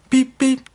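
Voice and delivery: high piped beep beep; a toddler copying the whistle; baby beep. A narrator's reading. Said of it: "said Lily".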